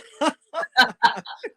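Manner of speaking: loud laugh. Real, not acted. Laughing about lifetime joke.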